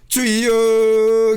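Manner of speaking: loud, very drawn-out 주여; a stretched, loud plea; long prayer cry. Shown as a demonstration. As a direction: call on the Lord for a sick deacon. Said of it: "어떤 집사님이 생각하면서".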